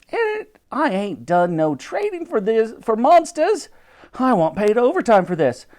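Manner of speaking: high-pitched, indignant officer voice; complaining policeman. A narrator's reading. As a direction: protest like a put-upon copper. Policemen grumble while fleeing the mammoth.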